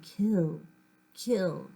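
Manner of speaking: a lightly voiced menacing whisper-like threat, low; mild hint of a sinister voice; scary voice. A narrator's reading. Mind-read stranger plotting murder, in a mildly scary voice. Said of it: "that scary voice saying".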